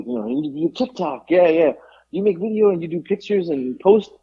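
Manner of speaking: lightly put-on Vietnamese accent of an excited shop owner; imitating the owner's broken English. Retelling the Vietnamese owner's request to the co-host, mild Vietnamese-accented imitation. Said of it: "you're speaking broken English".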